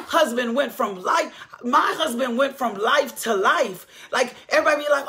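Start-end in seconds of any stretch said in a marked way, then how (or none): none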